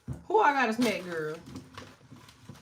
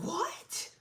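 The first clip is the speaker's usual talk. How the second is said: much higher then loud, horrified what-the-internet; shocked grandma; 4 semitones higher, 7 dB louder than usual. Retold or imitated. Vlogger mimicking old folks horrified by paying online. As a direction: gasp at the word internet like it's poison. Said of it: "they be like".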